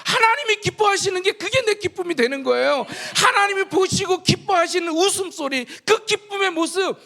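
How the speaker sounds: loud, high preaching climax; full-force preaching. Acted. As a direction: preach it out with fervor to the congregation. (힘차게 외치는 설교)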